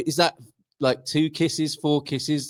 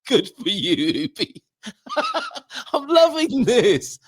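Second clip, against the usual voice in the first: laughing cheer; 11 semitones higher than usual. Real, not acted.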